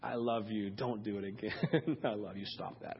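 soft, low, fond scolding; a lightly voiced parent to a child; loving parent. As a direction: scold gently with a laugh, fading to a whisper. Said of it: "you're like".

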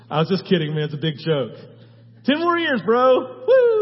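loud, very high joking celebration; mock Isaiah hype. Preacher voicing Isaiah telling Hezekiah he was kidding, loud hyped whoop. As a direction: whoop it up like a buddy pulling a prank. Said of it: "Isaiah walked back and says".

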